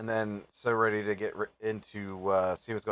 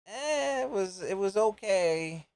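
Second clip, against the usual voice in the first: unimpressed; 9 semitones higher than usual. Retold or imitated. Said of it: "there's other times where it's like".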